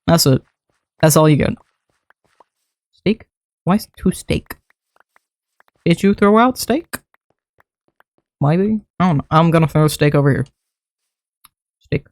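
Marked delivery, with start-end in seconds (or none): 2.9-12.1 soft mock-puzzled pet talk. Real, not acted. Talking to pet about steak.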